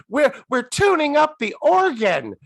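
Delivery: high, loud acted excuse; mock announcement. Cohost mimicking the show's excuse about the organ, high mock announcement.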